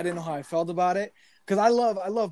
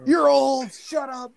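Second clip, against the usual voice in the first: put-on kid taunting; 8 semitones higher, 3 dB louder, much slower than usual. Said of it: "comeback as a kid".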